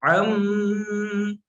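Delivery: a sung, nasal हम held in the nose; nasal ghunna. Shown as a demonstration. Teacher demonstrates ghunna to the class, humming through the nose. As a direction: hum हम through the nose, drawn out. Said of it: "ये इतना नाक में घूमना चाहिए".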